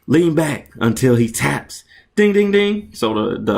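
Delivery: ordinary delivery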